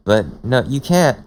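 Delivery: usual speaking voice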